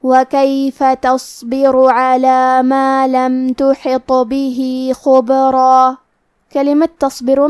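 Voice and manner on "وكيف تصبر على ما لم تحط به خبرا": Quran recitation. Acted. Teacher recites the verses for students, slow chant. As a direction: recite the verses slowly, melodic.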